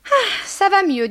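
very high, loud sigh of relief; relieved landlady. Acted. Madame Dehan after a glass of elixir, relieved. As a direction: exclaim with high-voiced relief. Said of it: "dit madame Dehan".

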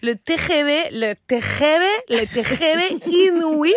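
T G V with a throaty Spanish jota for the G; Spanish accent struggling through "le T G V inouï"; Spanish mispronunciation. Acted. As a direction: labour over "T G V", rasping the G like Spanish J. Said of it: "que c'est difficile à dire".

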